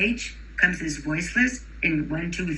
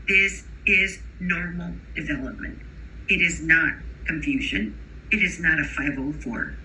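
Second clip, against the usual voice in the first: usual speaking voice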